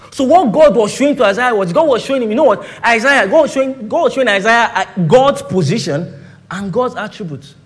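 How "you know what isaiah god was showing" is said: ordinary delivery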